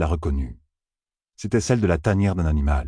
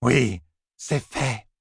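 slow eerie repetition; 6 semitones higher, much slower than usual. A narrator's reading. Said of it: "répéta une femme".

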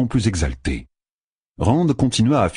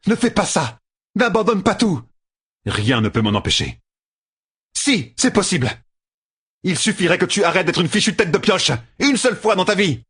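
much higher, urgent scolding female voice; Egwene pleading; 11 semitones higher than usual. A narrator's reading. Egwene begging Rand not to walk out, urgent and exasperated. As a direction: beg him, then scold him like an old friend.

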